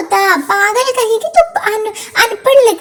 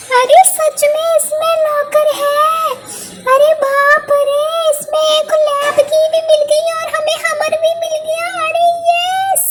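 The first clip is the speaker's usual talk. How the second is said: higher, thrilled exclamation; excited discovery; 4 semitones higher than usual. Acted. Kamla thrilled at finding the locker, higher voice. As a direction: burst out with joy at the find.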